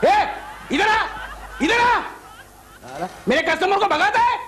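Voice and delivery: high-pitched, bossy telling-off; angry scolding. Acted. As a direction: summon the culprit and scold him. Boss summons and scolds meddling assistant.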